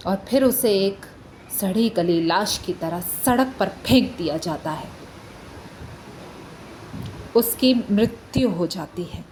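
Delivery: low, subdued and somber; grave recounting. Acted. Lawyer telling court of the victim's death, somber. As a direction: let the voice sink, with heavy pauses.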